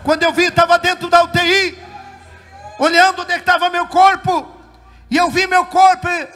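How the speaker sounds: loud, high-pitched revival testimony; full-force testimony preaching. Real, not acted. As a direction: preach it out to the whole church.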